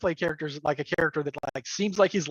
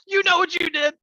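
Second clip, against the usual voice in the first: laughing accusation; 13 semitones higher, 5 dB louder than usual. Retold or imitated. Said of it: "players accusing the shape changer".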